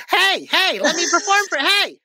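high, loud, needy hailing; mock eager calling. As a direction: pester passers-by for an audience. Acts lonely, pestering people to listen.

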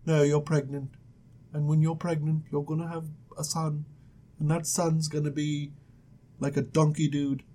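low, soft angel with pauses. Acted. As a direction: deliver the prophecy in a hushed, floaty voice. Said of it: "I need to come up with an angel voice".

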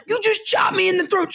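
high-pitched accusing voice; outraged kid. Retold or imitated. Kid accusing the para who chopped him, high protest. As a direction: imitate a kid's incredulous complaint.

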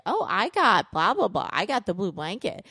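imitating a kid rubbing it in; gloating taunt. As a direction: tease like a triumphant little brother. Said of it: "rub it in his face".

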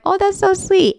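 high-pitched "aww, how sweet" reaction; touched gushing. Retold or imitated. Reader reacting to the author's kindness list, touched high gushing. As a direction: gush sweetly, pitched well up.

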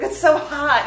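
high, loud complaint about heat; overheated whine. Retold or imitated. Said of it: "He's like".

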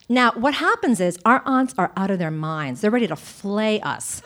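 ordinary talk

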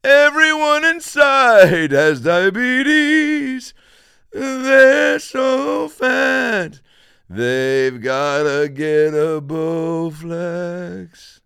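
high, loud, very slow booming put-on voice; over-the-top announcer voice. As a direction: drag every word out like a cartoon ad. Mock ad voice, unexplained.

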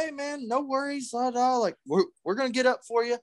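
overly polite apology. Retold or imitated. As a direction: act meek and eager to please. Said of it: "me and my dad were like".

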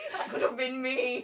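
loud, high laughing lament; mock bitter outcry. Bitter actor shooting the girl who got her part, mock outcry. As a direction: cry out in comic envy.

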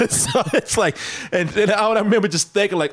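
high, laughing through the words. Real, not acted. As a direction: laugh as you remember the moment. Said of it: "laughing while retelling".

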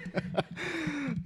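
high laugh. Real, not acted. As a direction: giggle breaking upward. Host laughs at sweater.

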